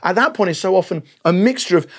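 ordinary talk, nothing marked

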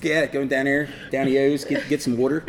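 imitating a Baltimore local; playful mock Baltimore accent. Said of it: "wicked Baltimore accent".

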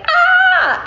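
high mouse shriek, acted; mock frightened scream. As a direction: let out a sudden high squeal of pretend fright.

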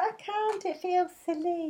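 high-pitched, softly mocking imitation of her; mock reluctant whine. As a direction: put on a silly, helpless little protest. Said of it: "She's like".

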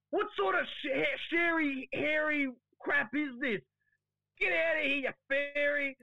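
high, loud mock outrage, slowed on hairy and fairy; hammed-up disgusted shout; outraged yell. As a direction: bellow it like a disgusted old footy host. Wayne's outraged retold line.

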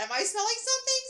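high mild question. Retold or imitated. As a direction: lift it high and innocent. Mild sniff quote, high.

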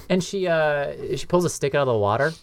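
ordinary delivery

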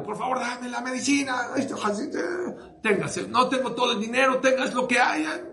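high, pleading mother begging the pharmacist; desperate pleading cry. Retold or imitated. Desperate mother begging the pharmacist for medicine, high pleading. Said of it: "le empezó a gritar al boticario".

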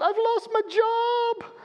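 high, slow, put-on wail of a distraught man; distraught caller. Retelling a panicked phone call, high wailing caller. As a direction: ham up the upset caller's plea.

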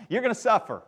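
chuckling through the punchline; a wry laugh to the congregation. Real, not acted. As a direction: laugh at the irony as you say it. Joke after Merry Christmas.

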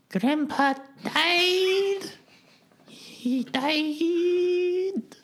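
very high, very slow squeaky wail; eulogy in that voice. Acted. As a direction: sob the eulogy in the cat voice. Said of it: "give her eulogy speech in that voice".

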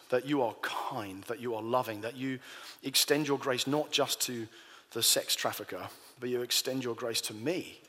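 soft, low closing prayer. Real, not acted. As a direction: pray quietly and humbly.

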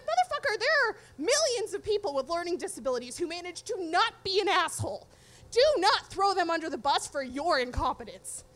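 loud, emphatic retold rant; acted outrage with forceful stresses; indignant telling-off. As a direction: tear into his lame excuse, hitting each point hard. Retold scolding of texting ex-guest.

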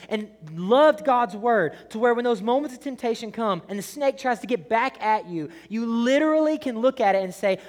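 loud, high-pitched, impassioned sermon delivery; urgent pulpit shouting, driving each phrase at the congregation; full-force preaching. Real, not acted. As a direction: preach it at the top of your voice.

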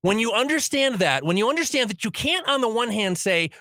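normal talking voice

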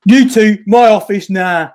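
loud, high barked order; angry boss. Retold or imitated. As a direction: bark it like a furious boss. Imitating Johnny Saint's order.

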